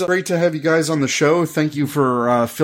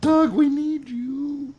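high pleading call; 12 semitones higher, 4 dB quieter, slower than usual. Acted. Calling out for absent Doug.

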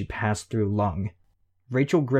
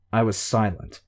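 usual speaking voice

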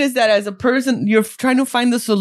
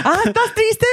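mock comforting parent; 10 semitones higher than usual. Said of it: "We are not doing what our parents did".

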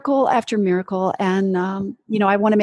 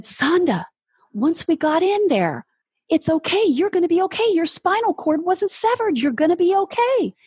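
choked-up doctor, voice pitched much higher; tearful relief; 10 semitones higher than usual. Retold or imitated. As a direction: tell her she's okay through tears. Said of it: "he had tears running down his face".

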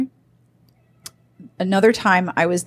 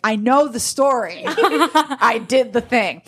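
laughing husband; 6 semitones higher, slower than usual. Retold or imitated. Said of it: "And he's like".

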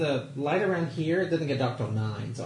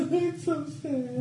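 mock sad; 12 semitones higher, much slower than usual. Mock sadness, high.

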